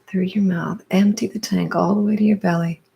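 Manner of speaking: slow, spaced-out breathing cues with drawn-out words; guided breathwork. Real, not acted. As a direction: lead the breath, pausing after each cue. Leads a guided breathing exercise.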